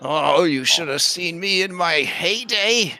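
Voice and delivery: lightly voiced old woman reminiscing; old woman bragging. Ida boasting about her heyday.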